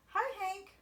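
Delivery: high friendly call; tumbleweed calling a cheery hi. A narrator's reading. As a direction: sing out a bright hello from afar. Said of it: "Rosie the tumbleweed called out".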